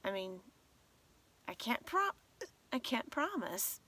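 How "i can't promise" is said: ordinary talk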